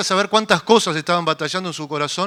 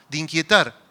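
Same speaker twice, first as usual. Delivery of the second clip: ordinary delivery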